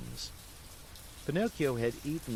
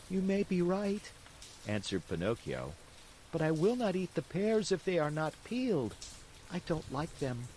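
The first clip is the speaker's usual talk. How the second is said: put-on stubborn boy; 7 semitones higher than usual. Pinocchio refuses.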